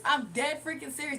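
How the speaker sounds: usual speaking voice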